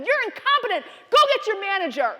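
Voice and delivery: very high, loud insulting order; angry customer screaming. Retold or imitated. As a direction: hurl it as a furious customer. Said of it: "still screaming about a Nissan".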